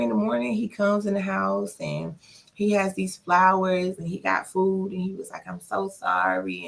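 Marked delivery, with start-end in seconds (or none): 5.5-6.7 low, slow mock apology as the boyfriend; lightly voiced sorry boyfriend; boyfriend's apology. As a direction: play him low and drawn out.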